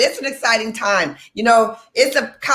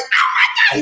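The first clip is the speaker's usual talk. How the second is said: very high-pitched excited scream. Retold or imitated. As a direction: scream it out, thrilled to be home. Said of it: "I started screaming".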